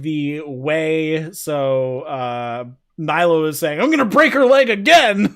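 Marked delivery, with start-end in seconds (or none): 3.8-5.3 loud, high mock wrestler threat; hammed-up raised-voice menace; shouted threat. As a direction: belt it out like a taunting wrestler.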